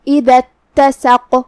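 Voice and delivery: slow demo of اتسق with the dal voiced clearly; clear dal sound. Shown as a demonstration. Teacher slowly showing the dal, slow careful demo. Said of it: "ہم دال کو وہیں سے پڑھیں گے اور اسے ظاہر کریں گے".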